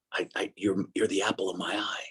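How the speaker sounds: a soft whisper of love; whispered tenderly. Retold or imitated. God whispering love to a guilty man. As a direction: whisper it like a secret blessing. God's tender words retold.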